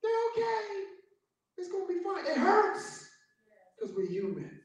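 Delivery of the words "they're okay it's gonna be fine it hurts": high, ringing preacher's cry at its peak; preaching at full force. Acted. Preacher comforts the grieving church, in a high crying shout. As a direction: lift the church up to the promise of God. Preacher's climax about the dead.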